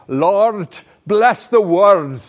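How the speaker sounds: loud, high, drawn-out Glaswegian Scottish plea; dramatic Glasgow prayer. Retold or imitated. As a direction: boom it out like the Glasgow warden praying. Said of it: "so much more dramatic and so much more, uh, powerful".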